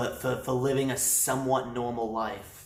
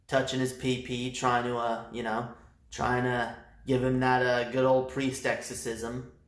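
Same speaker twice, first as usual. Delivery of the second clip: ordinary talk, nothing marked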